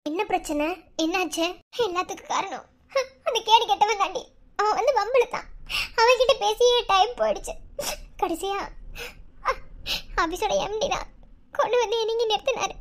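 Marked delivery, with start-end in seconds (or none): none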